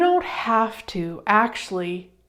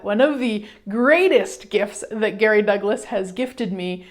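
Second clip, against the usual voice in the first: ordinary talk, nothing marked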